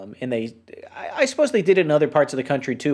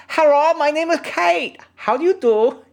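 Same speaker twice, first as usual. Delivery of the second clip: Kate's English; 12 semitones higher, 3 dB louder than usual. Retold or imitated. Quoting the Canadian anime character Kate, high and stilted. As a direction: mimic an anime girl reciting textbook English. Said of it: "she speaks Japanese with a shitty Canadian accent".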